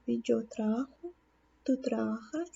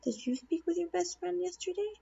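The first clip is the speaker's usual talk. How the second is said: higher, polite student question; student asking teacher; 6 semitones higher than usual. Pretend. Student politely asking the teacher about her best friend. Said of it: "if you were talking to me and you wanted to say".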